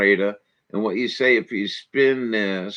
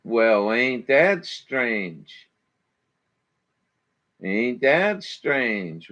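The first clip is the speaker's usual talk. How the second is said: slow sarcastic drawl; much slower than usual. Real, not acted. Muses on being blocked again.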